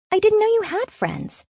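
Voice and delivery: very high-pitched, amazed remark; astonished sister. A narrator's reading. Sister surprised she has friends, astonished. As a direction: blurt it in a high, disbelieving voice.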